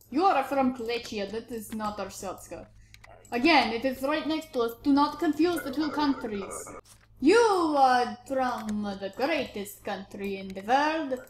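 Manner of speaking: put-on border officer in a vaguely German accent; muddled German-like accent. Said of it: "Is this German?".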